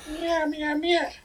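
high mock cat meowing; cat meows. Meowing at the host like a cat.

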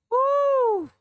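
high-pitched relieved woo. Retold or imitated. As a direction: let out a high shaky woo. Man relieved after bear.

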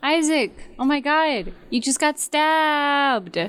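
high-pitched, drawn-out shocked exclamations; acted alarm. Player finding companion Isaac stabbed, high acted alarm. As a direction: play shocked, stretching the last words out high.

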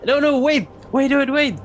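high-pitched, pleading wait; character begging them to hold on; urgent protest. Acted. Player voices character's urgent plea.

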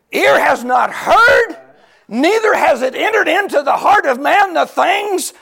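loud, high, slow crying shout; full-force preaching. Acted. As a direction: preach it with tears and fire.

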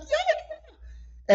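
very high laughing yes, re-enacted; gleeful squeal. Woman answering the man who found she's French, gleeful squeal. Her delighted reply, acted out.

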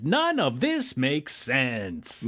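slow, drawn-out disbelief; exasperated. Real, not acted. Recapper giving up on the inverter ray science, slow exasperation. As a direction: stretch each word in exasperation. Exasperated verdict on nonsense plot.

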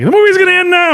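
high, loud hammed-up announcement; over-the-top high proclamation; mock excited shout. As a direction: yell it out in a very high voice. Mock announcement of film's abrupt ending.

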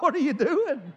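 high, sharp cry of alarm; startled dad. Retold or imitated. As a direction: catch the baby chewing lamp wires. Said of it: "I'm like".

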